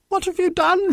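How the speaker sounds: very high, dismayed shout; shouted question. A narrator's reading. Nuka seeing the mouse caged, shouting. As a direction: cry it out high in reproach. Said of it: "shouted Nuka".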